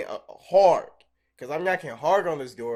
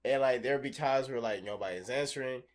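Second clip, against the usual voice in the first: usual speaking voice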